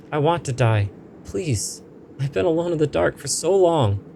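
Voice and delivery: high, pleading spirit voice; lonely ghost pleading; ghost's lament. Acted. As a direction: beg as if starved of company.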